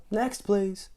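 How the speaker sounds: high brusque call for the next one; mild loud call. Acted. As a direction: dismiss him. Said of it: "thundered the grocer".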